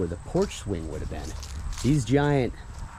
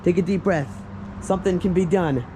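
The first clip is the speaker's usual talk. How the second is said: raised-pitch imitated plea to calm down; mock urgent soothing; 8 semitones higher than usual. Vlogger as man pleading with hyperventilating woman, mock urgent soothing.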